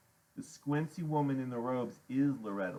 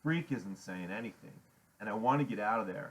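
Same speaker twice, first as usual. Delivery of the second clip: usual speaking voice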